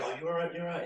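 a hint of a reassuring English supporter; mock reassuring fans. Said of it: "And they're like".

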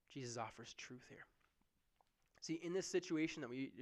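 ordinary talk, nothing marked

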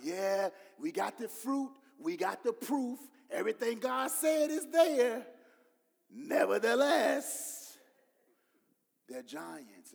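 a lightly acted spy building to a loud nevertheless; hammed-up report, swelling then dropping; doubting spies. Preacher voicing the fearful spies, mock dramatic.

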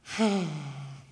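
long, breathed-out sigh; mock self-pitying sigh. Preacher playing a sorry-for-himself Jesus, theatrical sigh. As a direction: heave a put-on sigh of self-pity.

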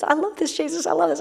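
high, soft mock-blissful quote; mildly giddy quote. Preacher voicing the husband walking in love, lightly mimicked delight.

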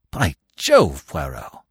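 a mild exclamation, dropping on the name; exclaiming. A narrator's reading. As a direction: exclaim with restrained surprise. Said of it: "I exclaimed".

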